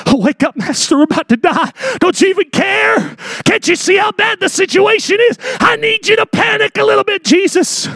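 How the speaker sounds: high-pitched frantic pleading; panicked disciples. Acted. Disciples waking Jesus in the storm, in a high panic. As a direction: wail it as if the boat is sinking. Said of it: "Disciples come to him in a panic".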